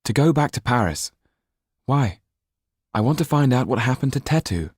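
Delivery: put-on defiant runaway boy; Jan's voice.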